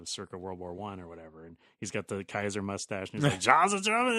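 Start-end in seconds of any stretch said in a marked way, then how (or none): 3.4-4.2 put-on high, loud shouted German accent; heavy German accent. Imitating the Goldfinger actor's heavy German accent, loud and high. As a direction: bark it out like a Kaiser-moustached officer. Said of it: "talk in his heavy, thick accent".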